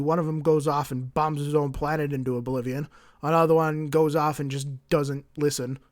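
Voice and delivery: ordinary delivery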